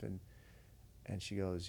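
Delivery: normal talking voice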